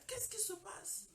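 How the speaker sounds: whispered, slow and alarmed; startled whisper. Retold or imitated. Scared doctor asks the crying mother, whispering. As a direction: ask it in a frightened hush.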